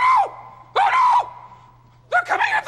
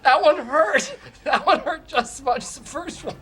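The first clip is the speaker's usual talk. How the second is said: laughing through pain; 5 semitones higher than usual. Real, not acted. Laughing after chest waxing.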